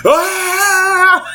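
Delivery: very high mock scream; imitated war cry. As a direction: shriek like a tiny penguin charging. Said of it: "the one on his right goes".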